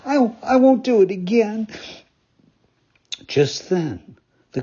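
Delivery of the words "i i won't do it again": higher, tearful stammered promise; in tears. Acted. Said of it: "Pinocchio in tears".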